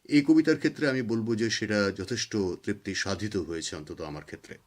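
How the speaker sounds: ordinary talk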